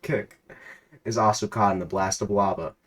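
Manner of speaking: measured storyteller read of a game scene; reading out the game's story to a friend, narrator voice; story narration. A narrator's reading. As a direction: narrate the adventure steadily.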